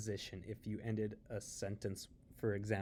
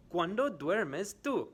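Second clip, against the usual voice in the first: "¿Cuándo duermes tú?" high and joined up, "cuándo" stressed; high, clear teacher question; quiz question in one go; 8 semitones higher, 6 dB louder than usual. Shown as a demonstration. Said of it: "I am going to ask some questions".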